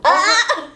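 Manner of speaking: high, loud laughing shriek; a sudden startled yelp; surprised shriek. Real, not acted. Stress meter reads overload.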